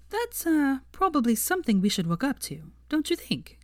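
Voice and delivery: high, playful teasing. Acted. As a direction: tease him lightly. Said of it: "she teased".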